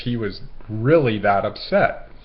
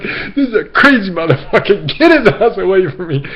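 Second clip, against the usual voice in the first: loud, high, rushed mock yell; fast, frantic shout while fleeing; imitating a freaked-out man; panicked; 9 semitones higher, 9 dB louder than usual. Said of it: "he's like".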